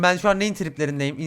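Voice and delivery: usual speaking voice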